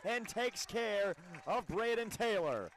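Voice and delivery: high, loud, stretched sportscaster shout; excited play call. Real, not acted. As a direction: belt out the big play with real excitement. Live call of great play.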